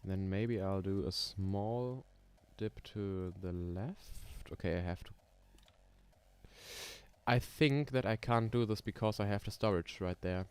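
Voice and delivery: usual speaking voice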